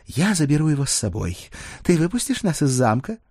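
Dana, businesslike. Acted. Dana announces she is taking the prisoner, in command.

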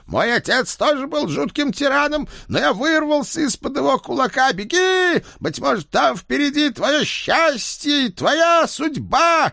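very high, stirring shout to run; a hammed-up rallying cry; rousing urging. Ed urging the girl to run to her destiny, very high and rousing. Ed egging daughter on.

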